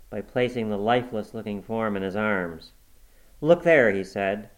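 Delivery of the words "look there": raised, loud call to look; sharp command. A narrator's reading. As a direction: command attention, high and forceful. Husband confronted with lifeless wife.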